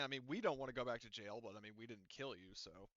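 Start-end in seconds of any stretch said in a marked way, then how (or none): none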